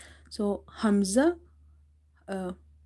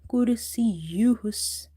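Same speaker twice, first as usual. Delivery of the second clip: Quran recitation; 4 dB louder than usual. Shown as a demonstration. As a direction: recite with long, held vowels. Recites the verse for learners.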